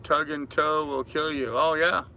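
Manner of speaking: ordinary delivery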